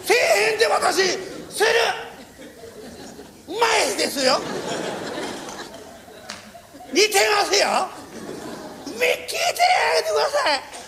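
high, giddy begging to be heard; giddy drunk master; drunk master pleading. Retold or imitated. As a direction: play a tipsy old master tugging at everyone. Drunk master insisting on impression.

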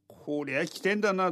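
ordinary delivery, nothing marked

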